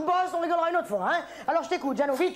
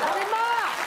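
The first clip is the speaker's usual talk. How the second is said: fed up; 5 semitones higher, slower than usual. Acted. Waiter losing his temper.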